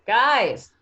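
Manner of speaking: sudden loud call to the group. Acted. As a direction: shout to get everyone's attention. Calls out after spotting campsite.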